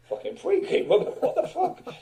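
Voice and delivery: retold quote breaking up in laughter; laughing. Singer retelling a remark about his voice, laughing. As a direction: laugh through the words, voice jumping up high.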